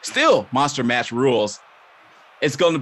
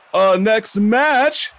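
ordinary talk

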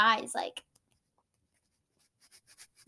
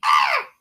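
high, loud shrieking creature cry; pterodactyl shriek; 11 dB louder than usual. Pretend. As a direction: let out a wild shriek. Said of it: "pterodactyl scream".

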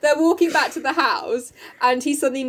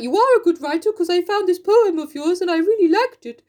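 higher-pitched put-on suitor voice; Mister Bear; 5 semitones higher than usual. Bear complimenting Jo's poem, put-on voice. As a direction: praise earnestly in a comic character voice. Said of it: "he's like".

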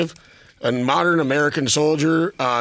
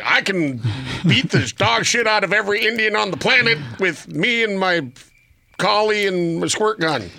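cocky captain quote; 6 semitones higher than usual. Retold or imitated. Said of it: "He's like".

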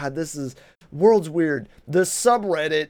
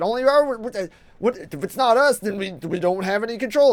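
mock panic; 6 semitones higher than usual. Voicing panicked hedge funds.

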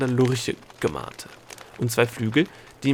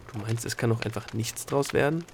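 plain reading voice

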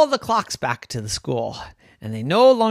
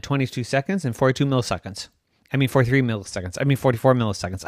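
fast, tumbling rattle of numbers; rushing the numbers; much faster than usual. Acted. Min rushing to keep up with time, talking fast. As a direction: race to keep up with the clock. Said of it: "I can't say it fast enough".

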